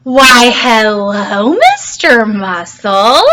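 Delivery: slow, loud sing-song hello; drawn-out, over-polite greeting; slow exaggerated greeting. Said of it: "give this another whirl".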